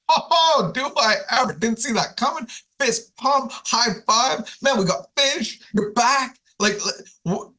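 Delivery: high, bubbling excitement; overjoyed Peter. Acted. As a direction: gush like a thrilled fan who can't believe it. Peter's joy at Jesus' question.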